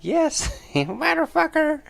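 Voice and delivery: very high, loud cackling crone; crazy old lady. Acted. Crazy old lady answers her victim, high cackling screech. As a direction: squawk it with an old hag's cackle. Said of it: "She's like".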